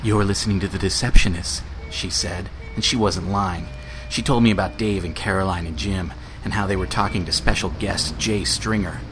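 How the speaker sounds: low, moody hardboiled narration; noir detective. Acted. Noir detective parody intro.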